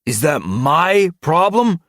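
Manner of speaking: loud, high, slow challenge; drawn-out irritated outburst; angry rebuke. Acted. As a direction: rebuke the underling hard. Furious leader rebukes underling.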